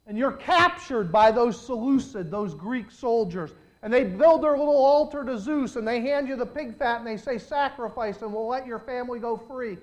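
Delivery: loud, driving preacher's cadence; preaching at full force. Acted. Pastor retelling the martyrs' capture, preached loud. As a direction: pound out the story to the congregation.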